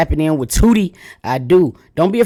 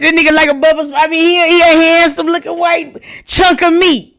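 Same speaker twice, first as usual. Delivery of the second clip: high, loud, laughing gush; giddy gushing; 10 semitones higher, 4 dB louder than usual. Real, not acted. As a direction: swoon over him, laughing. Gushing over man in clip.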